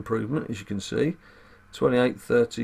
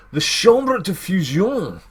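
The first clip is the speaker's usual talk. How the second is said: slow, loud, hammed-up French; mock French accent; 5 semitones higher, 5 dB louder, much slower than usual. Player announcing the fusion chamber, mock French accent. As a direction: draw it out in a pretend French accent.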